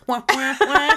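muted trombone wah-wah, rising at the end; Charlie Brown's teacher. Retold or imitated. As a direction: lightly mimic a cartoon adult's garbled talk. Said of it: "All I heard was Charlie Brown's teacher".

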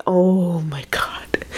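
slow breathy whisper; whispered shock. Real, not acted. Listener reacting to the scary story in a slow whisper. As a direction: breathe it out in disbelief.

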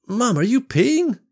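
put-on high, slow curious kid; a child asking, wide-eyed; young boy voice.

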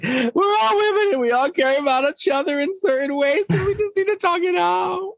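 very high wailing impression; hammed-up crying squeal; mock sobbing. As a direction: imitate a tearful plea, voice way up. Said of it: "sitting there sobbing and she's like".

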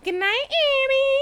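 high, loud silly mocking voice; stupid voice. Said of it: "doing a stupid voice".

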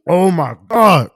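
loud, slow, drawn-out oh my God; loud slow delighted exclaim; food ecstasy. Real, not acted. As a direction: savor it like the best bite ever. Reacting to the spaghetti.

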